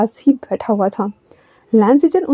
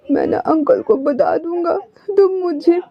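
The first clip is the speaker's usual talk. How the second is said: high, whiny tearful threat; 6 semitones higher than usual. A narrator's reading. Wing threatening to tell uncle, tearful. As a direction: pout and threaten through tears. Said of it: "अपने आंसू को wipe भी कर रहा था".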